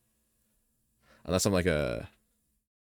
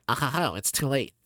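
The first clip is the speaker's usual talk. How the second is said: higher, gloating evil advisor voice; villain's gloat; 9 semitones higher, 4 dB louder than usual. Acted. Streamer slips back into Snevelius, gloating villain voice.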